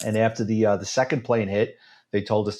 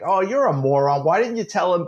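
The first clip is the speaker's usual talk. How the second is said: mocking scold; 7 semitones higher than usual. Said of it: "I was like".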